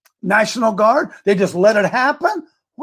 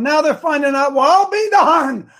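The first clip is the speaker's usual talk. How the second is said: sarcastic surprise; 6 semitones higher than usual. Real, not acted.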